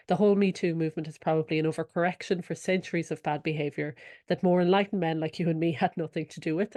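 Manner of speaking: ordinary talk, nothing marked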